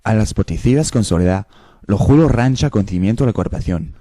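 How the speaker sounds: fast Spanish-sounding gibberish; fake Spanish. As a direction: rattle off fake Spanish quickly. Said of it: "uh, Spanish".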